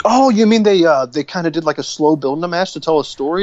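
high, exaggerated fake astonishment; sarcastic mock surprise.

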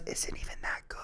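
whispered aside. Real, not acted. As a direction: whisper it off to the side.

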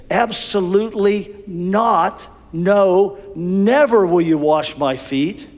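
slow Absolutely not, then a firm no; absolute refusal. Acted. Peter to Jesus, slow absolute refusal. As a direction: refuse with no possibility left. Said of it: "this is what Peter says".

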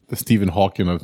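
ordinary delivery

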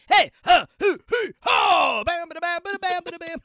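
high shouted hoots, then bam-bada scat; rock concert yells and scat. [name] character whooping and scatting high. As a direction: hype the crowd, then scat.

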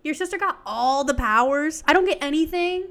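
high, playful sibling grievance; indignant mock complaint. Host imagining being Anna, mock indignant. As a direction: complain like the shortchanged little sister. Voices the powerless sister's complaint.

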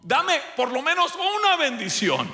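very high, wailing, tearful begging; crying plea. A narrator's reading. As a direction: sob out the plea for one blessing.

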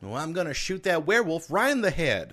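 put-on child voice. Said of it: "sent us a recording".

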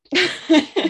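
loud, high laugh; happy laugh. Real, not acted. Laughing after sharing her healing story. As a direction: let out a joyful laugh.

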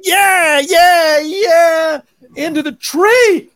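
high, loud whooping, drawn out; acted crowd cheer. Re-enacting onlookers as the lantern rises, acted crowd cheer. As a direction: cheer it upward till it goes wrong.

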